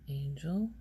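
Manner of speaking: normal talking voice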